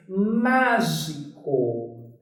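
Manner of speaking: mágico esticado e grave; very slow word. Shown as a demonstration. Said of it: "Outra palavra". (mágico dito muito devagar, em tom mais grave)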